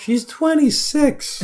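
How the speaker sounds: exasperated protest. Real, not acted. Protests the old lady joke.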